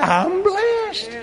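high, laughing exclamation of blessing; joyful exclamation. Shown as a demonstration. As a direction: burst out with delight. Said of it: "That's exactly right".

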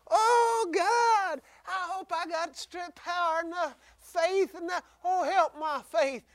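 high, frantic pleading, mildly hammed-up; mock a disciple begging God in panic; mock panicked prayer. Preacher mocking a frightened minister's prayer, high frantic pleading. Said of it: "Someone say".

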